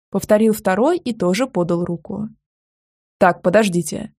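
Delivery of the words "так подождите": ordinary delivery, nothing marked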